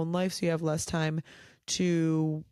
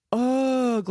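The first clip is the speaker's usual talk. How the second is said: disgusted ugh; 6 semitones higher, 6 dB louder than usual. Real, not acted. Disgust at lonely men topic.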